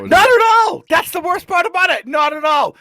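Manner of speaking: emphatic outburst. Real, not acted. Asked if he finished, he loudly protests not at all. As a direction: blurt it out, pitch way up.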